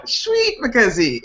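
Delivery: put-on high, drawn-out Afrikaans accent, laughing; Mike. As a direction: mimic a buddy's Afrikaans catchphrase slowly. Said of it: "That's my buddy Mike".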